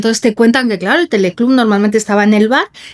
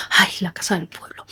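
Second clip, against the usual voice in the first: breathy sigh of nostalgia, almost no voice; nostalgic whisper; 4 semitones lower, 9 dB quieter than usual. Retold or imitated. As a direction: whisper it, longing for the family house in the village. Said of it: "todos hemos dicho". (susurro nostálgico)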